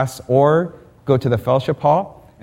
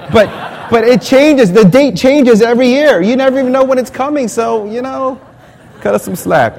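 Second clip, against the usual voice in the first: exasperated plea; 11 semitones higher, 4 dB louder than usual. Real, not acted. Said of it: "pleading rant".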